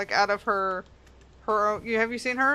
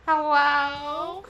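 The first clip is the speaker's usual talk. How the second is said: imitating grandma; 4 semitones higher than usual. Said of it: "when she'd answer the phone, she'd go".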